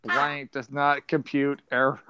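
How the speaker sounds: slow, monotone robot voice. Acted. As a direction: read it out like a machine, evenly and slowly. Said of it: "what has Robbie the Robot sound?".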